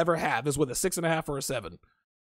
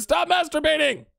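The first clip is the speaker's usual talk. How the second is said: mock shout; 11 semitones higher than usual. Reading chat comment, laughing.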